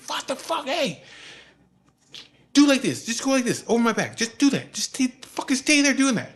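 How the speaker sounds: high, loud exasperated orders; flipping out. Retold or imitated. Father ordering son to swat flies off, flipping out. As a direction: imitate frantic bossing. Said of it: "he's flipping out".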